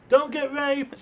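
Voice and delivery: yelled out loud after him; mock yell. Partner yelling a parting joke after him, loud. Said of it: "just yell after him".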